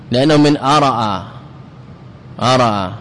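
ordinary talk, nothing marked

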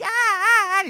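high, hammed-up little shrieks of fright; mock ladies' shriek. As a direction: squeal like a panicked little lady. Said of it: "les petites madames crient".

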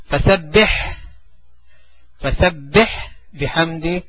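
slow drill. Shown as a demonstration. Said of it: "teacher repeats verse slowly".

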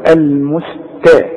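"المستا" slow, with thin m, s and t; light m and s. Shown as a demonstration. Teacher models the fix, slow light sounds in "المستا". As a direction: keep "المستا" light, syllable by syllable. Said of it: "مرققة الميم والسين".

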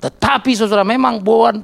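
slow, solemn mock sermon voice; hammed-up preacher, dragging the words. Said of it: "Ya, lo khotbah".

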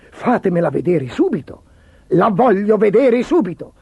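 emperor's insistent command; imperious order. A narrator's reading. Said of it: "ordinò l'imperatore".

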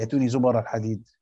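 zubara al-hadid, b given a fatha. Shown as a demonstration. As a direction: open the b in zubara. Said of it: "الباء مفتوحة". (زُبَرَ بفتح الباء)

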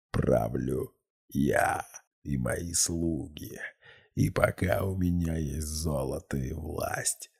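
slow, soft otherworldly menace; sinister demonic voice. A narrator's reading. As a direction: speak like something from beneath. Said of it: "раздался зловещий голос".

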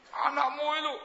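high put-on voice of a sulky wife; wife complaining. Wife complaining to husband, high whiny put-on voice. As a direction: whine it like an upset wife blaming him.

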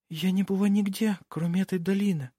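put-on high, delicate young maiden; innocent young girl. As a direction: play a sheltered girl, high and gentle. Voices Nikola, the young girl.

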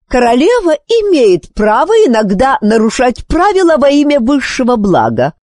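high, defensive royal retort; flustered quick reply. A narrator's reading. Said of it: "быстро ответила королева Психора".

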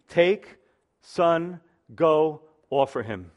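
TAKE, SON, GO, OFFER HIM as separate punched commands; very emphatic. Shown as a demonstration. Preacher showing how the Hebrew reads, very emphatic. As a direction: hit Take and son hard, then drop on "go, offer him". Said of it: "Very emphatic, very to the point".

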